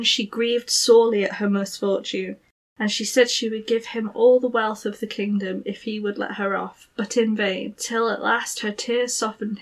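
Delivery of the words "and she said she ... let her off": plain reading voice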